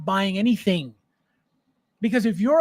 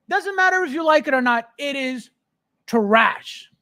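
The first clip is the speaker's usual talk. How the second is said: usual speaking voice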